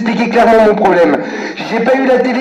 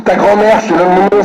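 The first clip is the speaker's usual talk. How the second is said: usual speaking voice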